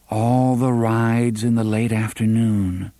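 ordinary delivery, nothing marked

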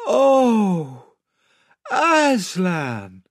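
put-on high, anguished child's cry; despairing. Children told they will never return, despairing. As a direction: cry out in dismay, pitched high. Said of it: "in despairing voices".

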